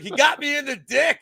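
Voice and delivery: high-pitched imitation of a commentator; Cormier. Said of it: "Cormier was like".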